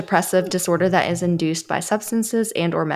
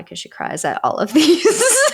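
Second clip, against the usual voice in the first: laughing; 9 semitones higher than usual. Real, not acted. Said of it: "laughing while teasing".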